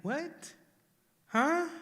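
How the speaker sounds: sudden high, startled What, then Huh; stunned disbelief. Retold or imitated. A grieving person caught off guard by the death, bewildered disbelief. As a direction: react as if the news just hit you. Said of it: "you still can be like".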